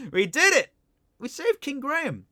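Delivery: triumphant cheer at the end of the game; excited cheer. Real, not acted. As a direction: celebrate the win out loud. Streamer cheers game's ending.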